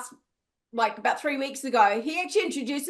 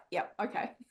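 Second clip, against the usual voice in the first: low, soft, deadpan "sure, buddy"; polite doubt, muttered low and quiet; unconvinced; 6 semitones lower, 11 dB quieter than usual. Retold or imitated. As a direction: humour him under your breath. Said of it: "You're like".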